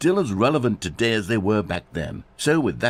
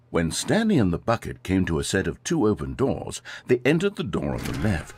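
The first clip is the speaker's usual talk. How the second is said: story narration; 6 semitones lower than usual. Shown as a demonstration. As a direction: read it like a polished story opener. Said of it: "Here we go. You ready?".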